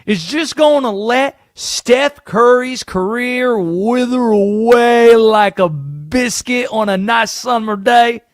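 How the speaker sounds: a conspiracy sports host drawling his rigging theory; Southern Conspiracy Josh voice. Acted. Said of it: "Where did this accent come from?".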